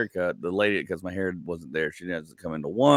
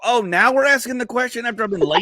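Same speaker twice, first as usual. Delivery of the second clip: higher, louder mock-indignant comeback; sarcastic retort; 6 dB louder than usual. Husband snapping back at his wife, sarcastic and loud. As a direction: throw it back at her sarcastically.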